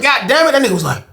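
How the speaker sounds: usual speaking voice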